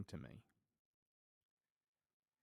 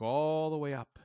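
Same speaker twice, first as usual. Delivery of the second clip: higher, louder, stretched "all" arching upward; upward sweep; 6 semitones higher, 9 dB louder than usual. Retold or imitated. Climber looks up the pole, high stretched "all". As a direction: stretch out "all" high and loud to picture height. Pitch pictures looking up the pole.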